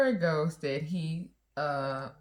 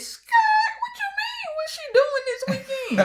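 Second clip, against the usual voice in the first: much higher, laughing outrage; indignant inner shriek; 4 dB louder than usual. Retold or imitated. Wife's head reacting to teller flirting, laughing and much higher. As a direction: squeal in mock disbelief.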